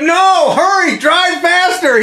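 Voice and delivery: very high, drawn-out urgent shout; acted frantic yell. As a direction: yell desperately toward the driver. Patient urges driver on in agony.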